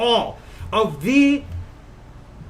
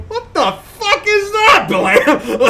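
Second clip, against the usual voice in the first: confused driver; 11 semitones higher, 4 dB louder, much slower than usual. Retold or imitated. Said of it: "everyone will spend more time going".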